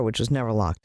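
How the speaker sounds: usual speaking voice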